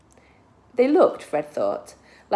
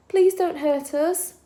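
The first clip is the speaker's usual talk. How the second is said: put-on high, slow, soft non-British child's voice; soft child's plea; 9 semitones higher, 3 dB louder, slower than usual. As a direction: beg gently like a scared child. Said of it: "The accent wasn't British. It was something softer".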